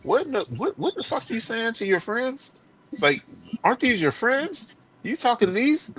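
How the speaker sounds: high-pitched, incredulous mom voice; mock motherly disbelief, high but not shouted; scolding mother. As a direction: imitate an appalled mother, pitched well up. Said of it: "my mother came outside. She said".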